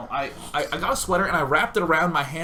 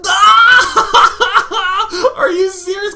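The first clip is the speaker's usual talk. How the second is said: very high, loud hammed-up cackling; bursting into laughter; 13 dB louder, slower than usual. Mom mocking son's bug fear, bursting into laughter. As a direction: imitate mom howling with laughter, through the words. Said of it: "bursts into laughter".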